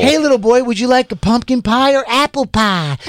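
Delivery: hammed-up granny voice; creepy old lady. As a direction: lure the boy like a sweet granny. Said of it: "Hey, hey".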